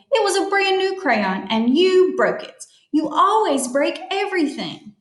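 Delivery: high-pitched, crabby storybook scolding; indignant accusation. Acted. Tilly blames her friend for the broken crayon, crabbily. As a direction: pile on the blame, pitched well up.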